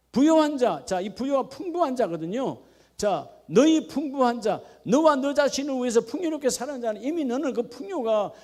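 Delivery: impassioned preacher building to a peak; full-force preaching. Acted. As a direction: preach it out with fire.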